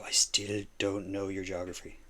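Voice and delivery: ordinary talk, nothing marked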